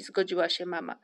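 usual speaking voice

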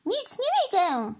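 high-pitched exclamation; calling out. A narrator's reading. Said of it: "zawołała".

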